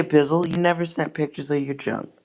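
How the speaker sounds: normal talking voice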